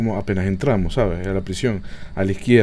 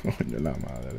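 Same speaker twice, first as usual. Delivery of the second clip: whispering while hiding; 8 semitones lower, 12 dB quieter, slower than usual. Real, not acted. Hiding while ghost hunts.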